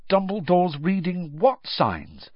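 high, slow, puzzled question; perplexed. A narrator's reading. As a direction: ask it in bafflement. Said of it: "looking perplexed".

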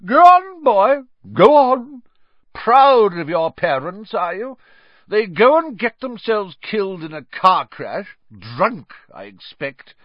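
very high, loud drunken mockery; loud high taunt; Aunt Marge, taunting. A narrator's reading. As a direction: goad him cruelly.

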